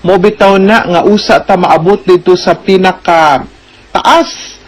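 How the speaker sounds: ordinary delivery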